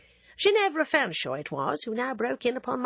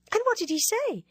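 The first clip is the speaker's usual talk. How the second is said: a coquettish schoolgirl needling her friend; teasing Ginevra; 11 semitones higher than usual. Acted. Coquette teasing narrator, higher lively voice.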